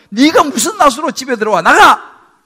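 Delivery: 화난 형의 고함 "나가!"; angry shout. Retold or imitated. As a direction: throw him out of the house. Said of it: "라고 말하지 않겠습니까".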